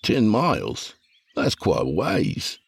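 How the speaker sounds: incredulous, slow scoff; mock disbelief at the distance. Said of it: "Todd scoffed".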